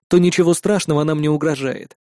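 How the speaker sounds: usual speaking voice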